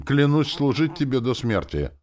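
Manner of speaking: high, loud ceremonial vow; solemn. A narrator's reading. Soldier swearing service to new lord, solemn. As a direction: proclaim the oath formally. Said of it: "торжественно заговорил парень".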